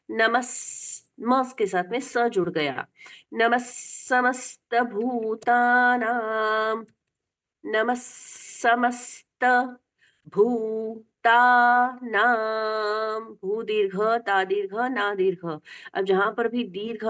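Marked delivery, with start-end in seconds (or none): 3.3-6.8 slow chant, नमः running into a doubled स; visarga as s. Shown as a demonstration. Teacher showing visarga sounded as स, slow chant. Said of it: "विसर्ग का उच्चारण स मानकर". 8.6-13.2 very slow chant, भूतानां long vowels stretched; drawn-out long vowels. Shown as a demonstration. Teacher singing the long vowels, very slow chant. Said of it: "खींच के गाना चाहिए".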